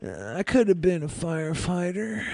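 half-awake caller impression; dim caller. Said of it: "He's sitting there like".

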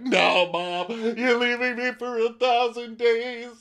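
put-on high, wailing child voice; mock-sob like a little boy; whiny sobbing kid. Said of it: "One of the kids is sobbing".

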